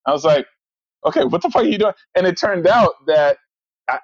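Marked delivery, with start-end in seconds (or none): 1.1-1.9 high-pitched, incredulous retold question; baffled exasperation. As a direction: act out being totally lost by a friend's answer. Exasperated at friend's cryptic answer.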